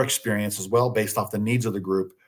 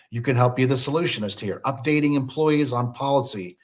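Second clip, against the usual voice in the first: normal talking voice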